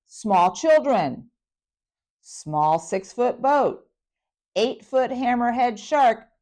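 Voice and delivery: slow, loud, deliberate listing; slow emphasis. Real, not acted. Mother stressing the danger to her audience, slow and loud. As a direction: lay each word down slowly for effect. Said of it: "Now picture this".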